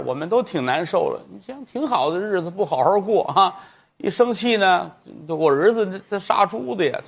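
put-on high old woman's voice, telling her tale; old mother pleading. Mother pleads her son's case to the magistrate, high-voiced.

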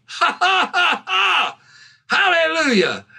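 high, gleeful retort bursting into ha-has; triumphant mock laughter. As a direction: act out laughing victory at the devil. Retold man laughs at the devil.

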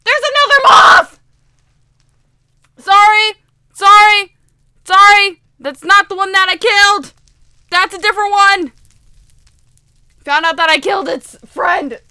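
loud, high, alarmed yelling; panicked screaming. Real, not acted. Player spots another moth after killing one, panicked yelling. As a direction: shout in real fright. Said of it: "I'm sorry for screaming just then".

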